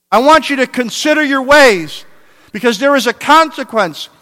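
preaching at full force. Acted. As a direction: preach it to a roaring climax. Preacher building to climax.

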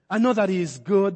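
ordinary delivery

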